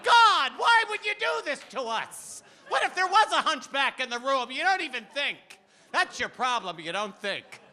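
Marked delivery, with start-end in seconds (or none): 0.0-2.4 high, loud mock wail to heaven; exasperated outcry. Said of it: "My mother said". 2.7-5.4 scolding mother. Retold or imitated. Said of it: "My mother said".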